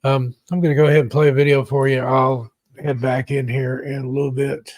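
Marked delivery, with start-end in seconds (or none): none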